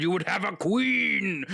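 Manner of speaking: lightly voiced grand queen, slow and high; a hint of a grand queen, slowed down; mock Galadriel. A friend voicing the ring temptation, slow mock grandeur. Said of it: "Gender bent Gandalf".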